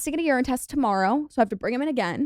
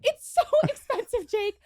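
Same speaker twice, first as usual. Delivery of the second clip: higher, exasperated laughing protest; 12 semitones higher, 3 dB louder than usual. Real, not acted. Answering her co-host about insulin prices, exasperated and high.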